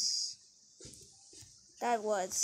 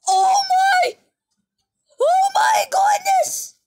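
very high, loud cries; shocked shriek; 15 dB louder than usual. Real, not acted. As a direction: scream in disbelief. Reacting to giant explosion.

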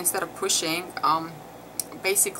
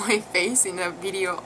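usual speaking voice

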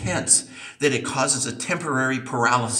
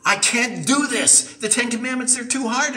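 higher, strained cry of giving up; desperate cry to God; 12 semitones higher than usual. Retold or imitated. Believer calling out to God in despair, higher strained voice. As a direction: cry out that you can't do it. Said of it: "we call out to him".

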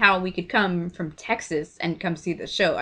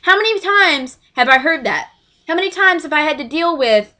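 raised pitch, emphatic complaint; exasperated rant; 6 semitones higher, 4 dB louder than usual. Real, not acted. Sister venting about endless picture complaints, exasperated. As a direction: demand it as if fed up.

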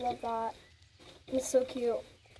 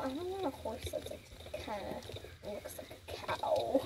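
whispered aside; 12 dB quieter than usual. Real, not acted.

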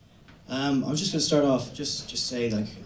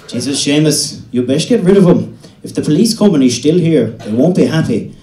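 loud, higher alarmed warning; dad warning; 4 semitones higher, 11 dB louder than usual. Retold or imitated. Dad warning Seamus about the police, loud and higher. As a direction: raise the alarm at the farmer.

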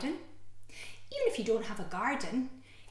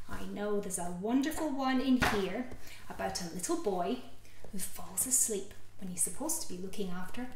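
plain reading voice

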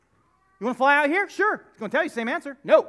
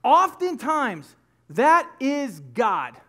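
slow emphatic point; 4 dB louder, much slower than usual. Acted. Preacher lands key point.

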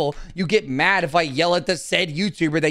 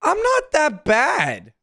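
high, slow whiny denial; defensive whine to chat; whiny protest; 11 semitones higher, much slower than usual. Real, not acted. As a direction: drag it out in a hurt whine. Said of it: "bitchy asshole vibes".

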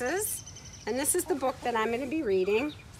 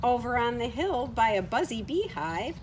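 lively, sing-song storybook reading; reading to children; 3 semitones lower than usual. Acted. Reading a farm story aloud to children at home, lively storybook voice. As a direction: read it brightly to little ones.

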